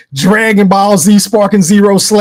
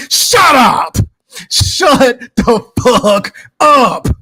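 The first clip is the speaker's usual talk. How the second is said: pitched-up outburst, then drawn out word by word; emphatic rebuff; 4 semitones higher, much slower than usual. Acted. Rebuffing fake apologizers, emphatic and exasperated. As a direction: cut them off, then stretch each word.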